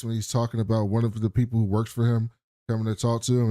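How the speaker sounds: usual speaking voice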